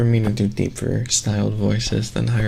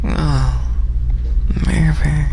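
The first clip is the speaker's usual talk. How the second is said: higher, airy, whispery delivery; breathy feminine voice; 4 semitones higher, slower than usual. Shown as a demonstration. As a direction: try a breathy woman's voice. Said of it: "but more breathy".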